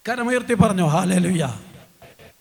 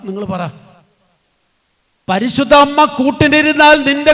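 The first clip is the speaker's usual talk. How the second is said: preaching at full force; 3 semitones higher, 7 dB louder than usual. Acted. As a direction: drive each phrase home to the crowd. Preacher exhorts congregation again.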